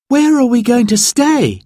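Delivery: put-on high, loud excited girl's question; eager child. A narrator's reading. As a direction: ask eagerly, bouncing. Narrator voices child Sarah.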